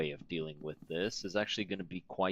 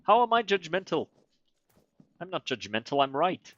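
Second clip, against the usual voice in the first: high, loud self-defence; indignant protest; 10 semitones higher, 8 dB louder than usual. Real, not acted. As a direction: protest loudly in a raised pitch, affronted. Mock protest at being called judgmental.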